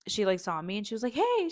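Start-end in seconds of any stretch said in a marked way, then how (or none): 1.2-1.5 bright greeting. Retold or imitated. Friend greets her, voiced.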